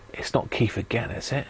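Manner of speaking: unvoiced conspiratorial whisper. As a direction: hush it like a secret. Whispered aside, then said aloud.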